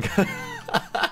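a sudden high squeal of laughter; squeaky laugh. Real, not acted. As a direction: burst into a tiny high shriek of a laugh. Reacting to the ugly Mario.

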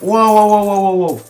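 rising burst of wows, high and loud; excited wow. Real, not acted. Gamer marvels at loot he just picked up, excited. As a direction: gasp over the loot, delighted.

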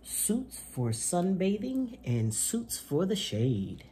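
bright, lively picture-book reading voice; reading to children. Acted. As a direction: read it to little kids with gusto. Reads picture book aloud.

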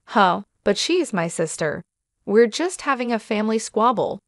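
plain reading voice

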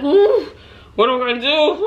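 hammed-up panic, high and loud; mock panic. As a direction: squeal it like a mock fret. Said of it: "I'm like".

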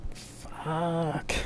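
soft, drawn-out inner curse; stunned thought. A narrator's reading. Narrator absorbs the creator revelation, a soft inward curse. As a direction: let the curse out softly, to yourself. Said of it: "I thought".

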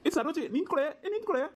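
high-pitched urgent call; calling after her. Acted. Husband calling his wife to stop as she leaves, urgent call. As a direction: call her back, pitch jumping up on the repeat.

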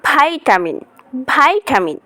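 ভাইটামিন with a long vai, American accent; American accent ভাইটামিন, said softly; American pronunciation. Shown as a demonstration. Teacher models American ভাইটামিন for students. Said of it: "American ল্যাঙ্গুয়েজে কী হবে?".

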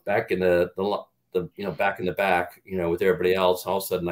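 usual speaking voice

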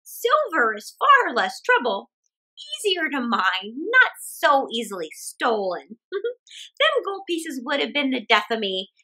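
put-on chatty old woman thinking aloud; cheerful old woman. Old woman muses over the pot in the ditch.

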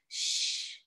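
soft unvoiced shh; a long, soft whispered shh; shushing. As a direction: hush the room gently. Hushing kids before sleep.

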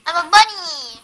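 loud cheery bunny call; playful shout. Pretend. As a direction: announce it proudly and loud.

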